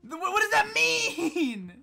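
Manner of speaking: high, stammering, drawn-out disbelief; exasperated bafflement. Retold or imitated. Player begging Brisbane to explain, exasperated bafflement. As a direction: stumble in, then wail the question slowly. Retold exasperation at unexplained slang.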